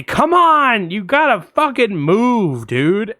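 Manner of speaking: high, loud pleading shout easing off; exasperated yell. Retold or imitated. Driver tells the cat blocking her car to move, exasperated yell. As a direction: start with a shout, then drag out the frustration.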